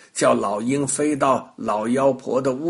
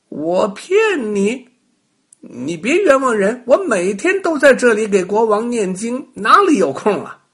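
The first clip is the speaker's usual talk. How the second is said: feigned innocence; 8 semitones higher, 6 dB louder than usual. A narrator's reading. Rabbit plays innocent to the witch, high. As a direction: play dumb sweetly. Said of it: "装作不懂的样子说".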